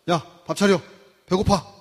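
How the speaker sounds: loud, curt demand for dinner; rude order. Acted. Husband ordering his wife to serve dinner, loud and curt. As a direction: bark it like a bossy husband.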